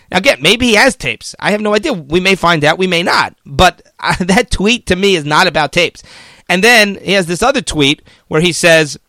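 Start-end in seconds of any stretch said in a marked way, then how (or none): none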